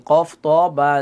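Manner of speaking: ordinary delivery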